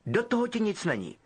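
a hint of a snooty prefect; Percy brushing off Ron curtly. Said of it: "pronesl odměřeně".

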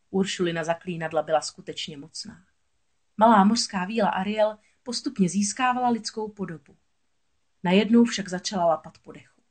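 plain reading voice

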